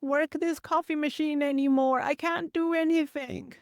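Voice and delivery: high-pitched, helpless wail, re-enacted; retold tearful despair. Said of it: "I just started crying".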